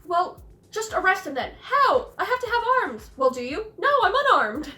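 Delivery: two hapless townsmen bickering; bumbling dialogue. Retold or imitated. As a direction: play both bumbling men, squeaky and flustered. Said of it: "It's like".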